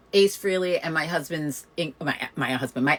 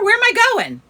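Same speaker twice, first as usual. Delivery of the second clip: incredulous; 5 dB louder, faster than usual. Real, not acted. Teasing, incredulous about party.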